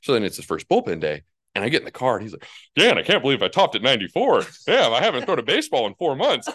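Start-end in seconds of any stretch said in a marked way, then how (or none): 2.8-6.5 excited brag. Retold or imitated. Friend bragging after bullpen.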